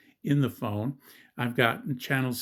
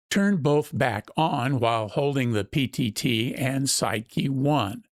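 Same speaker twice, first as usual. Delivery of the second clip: louder, polished product-ad voiceover; ad read; 2 semitones higher, 4 dB louder than usual. Acted. Host switching to a scripted promo read for the radio.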